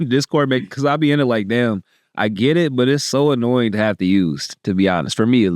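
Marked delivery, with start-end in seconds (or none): none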